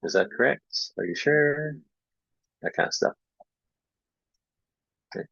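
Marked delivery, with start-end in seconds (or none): 0.0-1.8 higher doubtful questions; questioning prompt. Shown as a demonstration. Teacher asks a student whether their sentence is right. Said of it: "I pause or ask a question".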